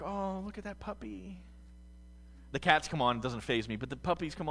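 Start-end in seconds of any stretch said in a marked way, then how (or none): 0.0-1.4 soft, cooing awe; mock tender coo. Viewer cooing softly at a puppy on TV. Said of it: "And we're like".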